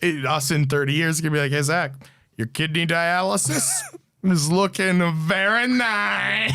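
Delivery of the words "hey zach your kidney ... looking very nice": slow mock-Kazakh catchphrase; comic foreign impression; Borat. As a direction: slide into a stretched-out Borat accent. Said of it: "A Borat impression is always top tier".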